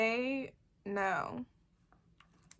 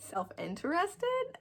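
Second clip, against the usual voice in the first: uncertain rising question; 9 semitones higher than usual. Real, not acted. Unsure word choice.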